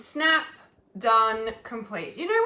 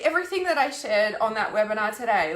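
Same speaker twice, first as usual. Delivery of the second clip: normal talking voice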